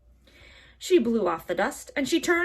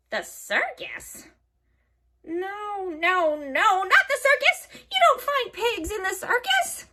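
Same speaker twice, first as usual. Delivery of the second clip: high, loud self-correcting hag; scolding witch; 11 semitones higher, 4 dB louder than usual. A narrator's reading. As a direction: scoff at the silly idea. Witch rejects her own idea.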